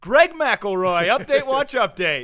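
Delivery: slow, loud and high, falling on the last word; mock segment announcer. Host announcing the Greg McElroy news segment, mock announcer. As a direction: boom out the name like an announcer.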